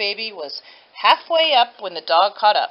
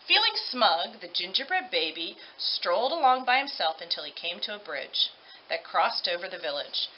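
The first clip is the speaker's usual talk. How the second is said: plain reading voice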